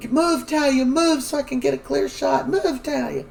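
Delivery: high yelled command to move; high mock-urgent shout; retold urgent yell. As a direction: bark it high to clear the shot. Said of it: "Jada's just screaming".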